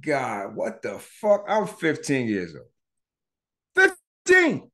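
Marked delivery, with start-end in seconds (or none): 4.3-4.7 disbelief. Real, not acted. Stunned repeat of his age.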